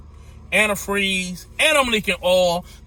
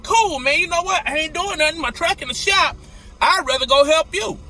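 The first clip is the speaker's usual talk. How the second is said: higher, upbeat and keen; eager offer; 8 semitones higher than usual. Retold or imitated. Offering his friend help moving, eager and higher. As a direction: sound glad to help.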